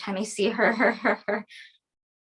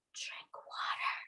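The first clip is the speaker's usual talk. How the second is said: whisper; 16 dB quieter, slower than usual. Acted. Soothing mother after episode.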